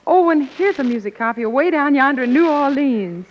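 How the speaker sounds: ordinary delivery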